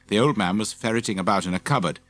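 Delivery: usual speaking voice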